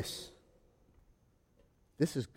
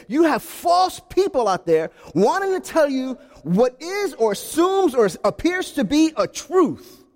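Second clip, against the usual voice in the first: usual speaking voice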